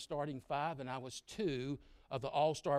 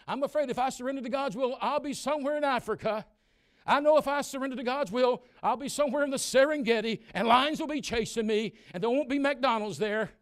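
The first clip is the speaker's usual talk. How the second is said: high, loud whiny panic; hammed-up frightened young believer; mock fearful youth; 11 semitones higher, 14 dB louder than usual. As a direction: ham up a scared teenager.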